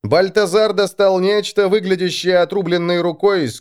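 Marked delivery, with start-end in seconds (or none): none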